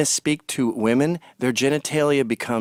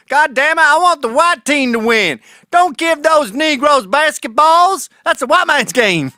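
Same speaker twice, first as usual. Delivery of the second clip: higher, louder mock bigot yelling; 11 semitones higher, 7 dB louder than usual. Loud mock fan yelling at a basketball game.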